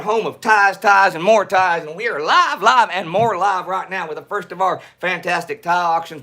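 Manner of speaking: high, hammed-up Texas showman intro; big Texas twang, announcer style; put-on Texas drawl. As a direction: open the show like a Texan pitchman. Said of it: "not gonna be talking like this".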